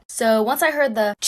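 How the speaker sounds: ordinary delivery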